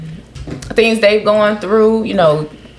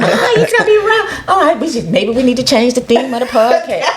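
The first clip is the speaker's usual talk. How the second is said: high, laughing imitation; mocking quote through laughter; 4 semitones higher than usual. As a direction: mimic them while cracking up. Mimicking others' complaints, laughing.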